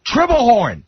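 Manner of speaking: high, drawn-out mock yell across a crowd; imitated heckling yell. Fan heckles the third base coach, imitated high yell. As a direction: mimic a voice cutting through the crowd. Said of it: "Artie's voice cuts through the whole crowd".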